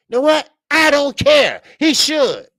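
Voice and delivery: high, loud furious yelling; angry shout. Real, not acted. Livestream host yelling about the referee's call. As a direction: let the anger burst out loud.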